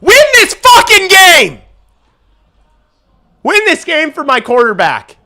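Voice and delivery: high, loud fan yell; cheering shout. Real, not acted. Fan yelling at the Blackhawks to win. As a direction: scream it at the team.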